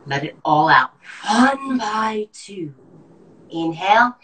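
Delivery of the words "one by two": slow loud forced count; lung function test. Shown as a demonstration. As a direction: push the breath out counting. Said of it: "I'm gonna demonstrate".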